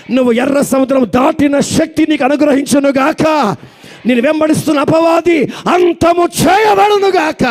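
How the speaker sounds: rising shouted benediction ending in amen. Real, not acted. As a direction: pronounce the blessing with full force. Preacher's blessing proclaimed loudly.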